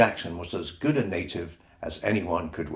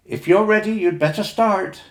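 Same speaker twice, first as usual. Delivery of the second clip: higher, gently urging mother's voice; a mother sending her children off, higher; a lightly voiced mother; 11 semitones higher, 6 dB louder than usual. A narrator's reading. Mother on the jetty urging the children to start, higher.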